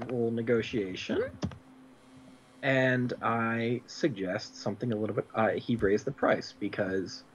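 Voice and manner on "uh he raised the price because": ordinary talk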